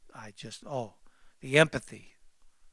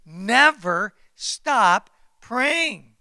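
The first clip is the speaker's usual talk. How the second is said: slow, louder never stop praying; louder repeat; 5 semitones higher, 11 dB louder, much slower than usual. Shown as a demonstration. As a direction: drive praying home louder.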